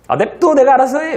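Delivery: put-on high, loud teen snapping back; sulky teen shout. Teacher voicing a teen yelling back at mom.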